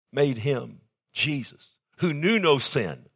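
ordinary delivery

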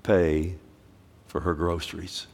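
low, spaced-out quiet command; hushed, solemn inner prompting; inner divine voice. Acted. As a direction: drop low like a prompting from within. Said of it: "You hear this voice".

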